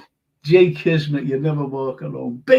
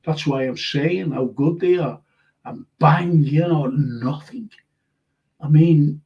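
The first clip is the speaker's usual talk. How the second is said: ordinary talk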